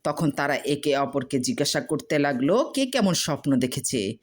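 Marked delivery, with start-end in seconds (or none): none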